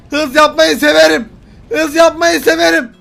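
loud, high speed thrill; exhilarated shout. Pretend. Driver on a dead straight road, exhilarated shout. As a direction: crow loudly about speeding.

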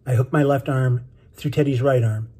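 usual speaking voice

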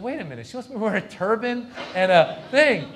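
loud, high double-take; alarmed realisation. Acted. Comic realising owner wants a costume, loudly incredulous. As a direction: blurt it out as it dawns on you.

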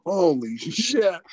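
retold shock, dragged out slowly; stunned. Wrestler learning he must play a backwoods creep, retold stunned reaction. Stunned by boss's character idea.

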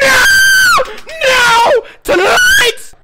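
high, panicked screaming; terrified wail. Real, not acted. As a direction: wail each word in fright. Screaming at the game's messages.

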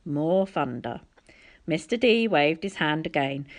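usual speaking voice

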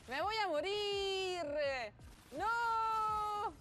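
high, drawn-out cries for help; panicked shouting. Real, not acted. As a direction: wail loudly as death closes in. About to die in the game. (gritos agudos de pánico)

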